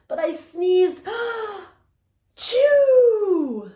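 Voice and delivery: high, loud gasp and explosive sneeze; huge acted sneeze. Wolf sneezing the stick house down with a loud gasp and sneeze. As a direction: blast it out like a giant sneeze.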